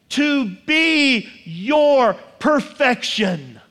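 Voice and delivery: every word drawn out and landed; slow emphatic reveal. Acted. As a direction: deliver it slowly, word by word. Climax after "You ready for this?.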